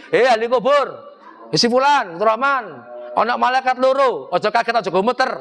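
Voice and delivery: mock talqin reciter. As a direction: call out to the dead like a talqin reader. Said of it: "Lu ngawur ae".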